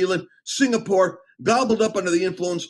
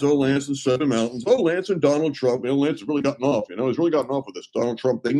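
lower, quicker, dismissive mock voice of critics; eye-rolling mockery; 5 semitones lower, faster than usual. Mimicking the religious establishment, dismissive and fast. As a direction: sneer it off like a bored critic. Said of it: "before they kinda roll their eyes".

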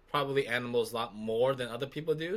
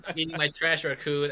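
shooing animals; 5 semitones higher, 5 dB louder than usual. Pretend.

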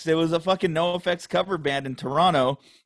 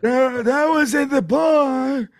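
high, slow mock drunk recall; 11 semitones higher, 6 dB louder, much slower than usual. Mocking drunk memory.

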